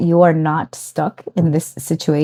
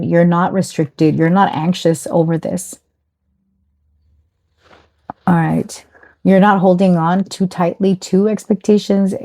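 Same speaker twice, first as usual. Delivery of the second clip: normal talking voice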